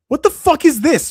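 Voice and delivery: loud incredulous outburst; exasperated yell. Real, not acted. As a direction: throw it up in outraged disbelief. Disbelief at a fighter's record.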